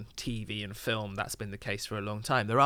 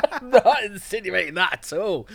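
laughing denial; 9 semitones higher, 10 dB louder than usual. Real, not acted. Denies comparing himself to doctor.

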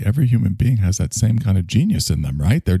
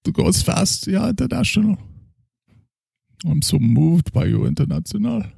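put-on a drunk German doctor praising his schnapps; heavy German accent, tipsy; 10 semitones higher than usual. Character continues.

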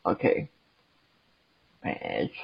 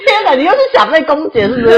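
high, loud laughter through words; laughing while teasing; laughing hard; 7 dB louder than usual. Real, not acted. (大笑着说)

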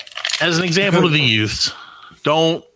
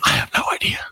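whispering; 12 semitones lower, 4 dB quieter, faster than usual. Real, not acted. Whispered aside.

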